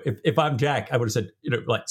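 usual speaking voice

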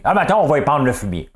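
high, loud mock politician; Denis Coderre. Host quoting a politician's manure line, loud mocking imitation. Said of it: "il dit qu'il va épandre le fumier".

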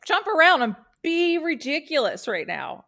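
loud, high imitated hype-up; mock workshop leader firing up the room; rousing call. Imitates the fun class's rousing call.

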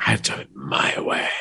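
low, breathy whisper of a rebel; whispered defiance. Retold or imitated. Voicing the defiant character.

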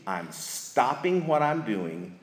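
normal talking voice

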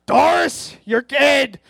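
imitating the father yelling his wife's name; dad yelling. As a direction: call out to your wife, blaming. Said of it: "He'd be like".

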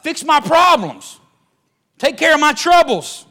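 high, loud, slow preacher's cry, sustained; preaching at full force. Acted. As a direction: shout it out from the pulpit, rising high. Preaching climax.

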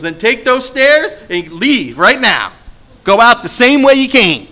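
loud, high, slow angry command; grandmother ordering out. Retold or imitated. Grandmother ordering the visitor to leave, loud angry. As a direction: throw him out the door with every word. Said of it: "He goes, she goes".

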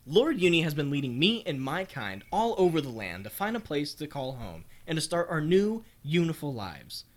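ordinary talk, nothing marked

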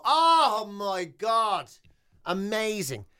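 ordinary talk

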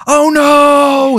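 loud shrieking oh no; high, loud mock shriek. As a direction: shriek as if dragged down to hell. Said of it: "Then it goes".

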